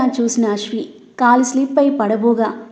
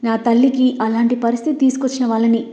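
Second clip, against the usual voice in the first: usual speaking voice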